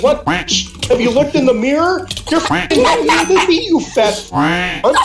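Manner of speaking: high-pitched, outraged retort; incredulous comeback. Retold or imitated. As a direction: fire back in indignant disbelief, voice way up high. Teller's outraged comeback to insult.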